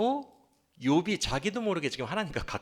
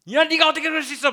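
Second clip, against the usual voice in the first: put-on high, fast, loud scolding of a child; angry parent scolding; 11 semitones higher, 6 dB louder, faster than usual. Parent scolding the children in anger mode, high fast yell. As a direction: snap at the kids like a furious parent. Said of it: "엄마 아빠가 지금 막 분노 모드가 돼가지고".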